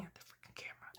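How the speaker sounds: quick whispered aside; whispering. Real, not acted. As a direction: whisper it to yourself. Aside about the camera.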